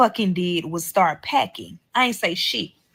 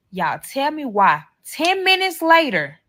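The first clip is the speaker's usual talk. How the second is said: ordinary talk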